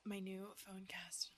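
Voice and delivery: slow breathy whisper; ASMR whisper. Retold or imitated. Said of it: "we were like".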